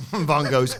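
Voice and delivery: ordinary talk, nothing marked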